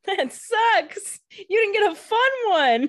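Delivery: high, whiny sympathy; mock whine. Real, not acted. Co-host commiserating about Elena's curse, high mock whine.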